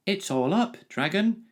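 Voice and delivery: put-on boy running to warn the dragon, high shout. A narrator's reading. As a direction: yell it up the hill to the dragon. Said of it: "he shouted".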